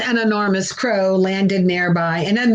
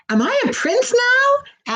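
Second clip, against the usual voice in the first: high frog voice. A narrator's reading. Frog asks the witch.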